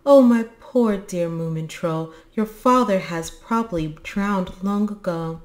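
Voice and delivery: narrated distressed cry; crying lament. A narrator's reading. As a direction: lament as a grieving mother. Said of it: "and she cried".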